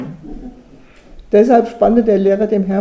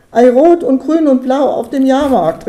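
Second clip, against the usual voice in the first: kindly eager call, higher and louder; 6 semitones higher, 6 dB louder than usual. A narrator's reading. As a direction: Hermann calls out excitedly to his father. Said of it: "rief Hermann". (aufgeregt, lauter und höher rufend)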